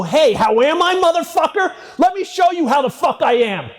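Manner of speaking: high-pitched furious yell, re-enacted; enraged retort. Victim to his abuser as he charges in, enraged yell. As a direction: throw the greeting back as a screamed threat.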